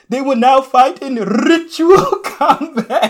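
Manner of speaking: put-on high, slow, overdone Wakandan accent; hammed-up African accent, drawn out. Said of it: "Everything he said".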